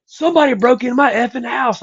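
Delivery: high, upset mock outburst; freaking out. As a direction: squeal it like a man losing it over a break-in. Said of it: "cussing and freaking out".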